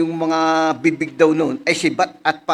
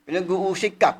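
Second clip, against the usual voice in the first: usual speaking voice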